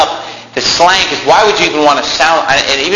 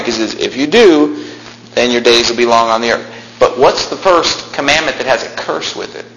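normal talking voice

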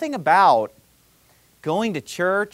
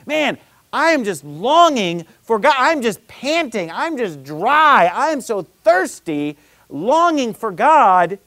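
drawn-out, higher-pitched yearning; impassioned longing; 5 semitones higher, 4 dB louder, slower than usual. Acted. Preacher voicing David thirsting for God, impassioned longing. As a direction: stretch each key word out with aching emphasis.

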